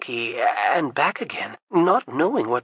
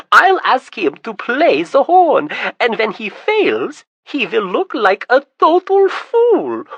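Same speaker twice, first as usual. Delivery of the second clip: much higher, louder, gloating glee; gleeful scheming; 8 semitones higher, 8 dB louder than usual. Acted. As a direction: crow over the plan to humiliate Tryon. Prince gleefully plotting his triumph.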